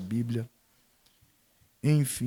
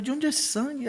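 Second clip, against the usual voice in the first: startled alarm; 12 semitones higher than usual. Retold or imitated. Father seeing blood on his hand in the hospital, startled. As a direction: jump way up in pitch, shocked.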